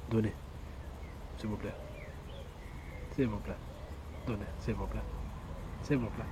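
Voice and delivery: soft, low, desperate begging; pleading beg. Pretend. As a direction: whimper it like a beggar. Begging for donations. (supplication très douce et plaintive)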